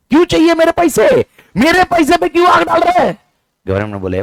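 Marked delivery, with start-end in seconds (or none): 0.1-3.2 high, loud outraged taxpayer; hammed-up high-pitched complaint; indignant protest. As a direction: demand answers at the top of your voice. Acts out protesting taxes to government.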